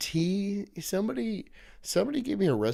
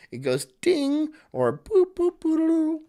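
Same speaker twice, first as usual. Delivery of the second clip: high sing-song ding boop; washer chime; 13 semitones higher, slower than usual. Retold or imitated. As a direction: chirp it like a washer beep.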